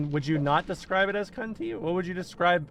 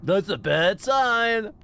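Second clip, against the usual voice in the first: mock Kafki; 6 semitones higher, 4 dB louder, slower than usual. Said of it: "Kafki's always like".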